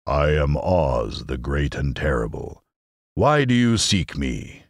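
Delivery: slow, low solemn proclamation rising on the question; deep, ponderous wizard's announcement; solemn, imposing voice. Acted. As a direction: intone it slowly as a great and terrible wizard. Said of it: "it said solemnly".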